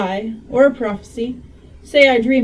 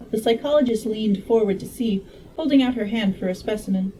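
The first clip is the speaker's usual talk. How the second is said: plain reading voice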